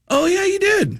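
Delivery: very high-pitched retold afterthought; high, put-on 'oh, right' voice; oblivious realisation. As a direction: imitate yourself remembering far too late. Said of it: "I looked like a total jerk".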